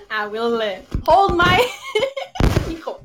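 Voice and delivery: laughing through the words; giggling while she fiddles with the mic. Real, not acted. Laughing while fixing her mic.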